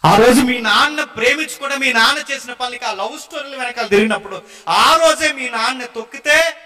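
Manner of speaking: loud fervent sermon; preaching at full force. Acted. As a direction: drive the point home to the congregation. Preacher raises voice to congregation.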